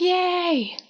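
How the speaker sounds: high, drawn-out little cheer; mock cheer. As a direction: imitate a gleeful hooray without volume. Retold cheer after the win.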